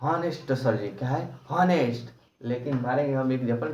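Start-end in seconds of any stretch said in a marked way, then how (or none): 0.0-0.9 हॉनेस्ट with a sounded H, answering sir; pupil's misreading. Acted. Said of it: "कहेगा". 1.5-2.1 हॉनेस्ट with a clear initial H; mispronounced honest. Shown as a demonstration. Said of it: "इसको हॉनेस्ट नहीं पढ़ेंगे".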